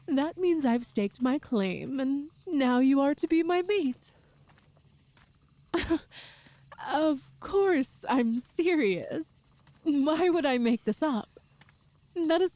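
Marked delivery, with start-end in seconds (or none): 5.7-6.2 short amused laugh; light laugh. Acted. Laughs at his disbelief.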